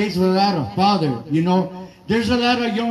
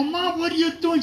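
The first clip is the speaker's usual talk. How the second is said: put-on shocked kid; 9 semitones higher, 3 dB quieter than usual. Kid shocked at mom fighting.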